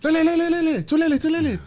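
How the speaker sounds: excited high-pitched Singaporean accent imitation, loud. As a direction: rattle it off as a high, mock Singaporean fan. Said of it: "I'm doing this guy's accent".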